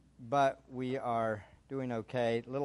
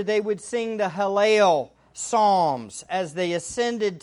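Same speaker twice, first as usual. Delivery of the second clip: rising, louder sermon delivery; preaching; 7 semitones higher, 6 dB louder than usual. Acted. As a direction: preach it with growing fervor. Preaching to congregation, building.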